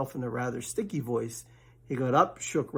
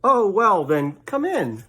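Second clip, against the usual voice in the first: higher, louder rabbit voice; grudging welcome; Rabbit's voice; 6 semitones higher, 7 dB louder, slower than usual. Acted. Rabbit lets Pooh in, higher and louder.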